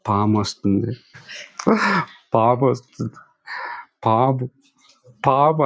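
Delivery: mock-timid, drawn-out call of snake with long gaps; slow timid calling. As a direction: call out the snake like a scared person barely raising the alarm. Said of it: "పాము అని నిదానంగా పిలిస్తే". (నిదానంగా భయంగా పిలవడం)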